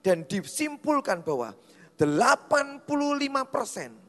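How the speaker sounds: high, loud pulpit proclamation; forceful preaching. Real, not acted. Preacher tells congregation attitude comes from within, forceful preaching. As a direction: drive the point home loudly to a congregation.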